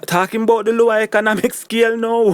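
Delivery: laughing through the words; amused, high laughing aside. Real, not acted. Laughs mid-line at own remark.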